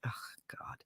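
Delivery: breath-only, very soft groan of words; imitating a friend's hushed dismay; dismayed whisper. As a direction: whisper it in dread. Friend's dismayed reaction, acted.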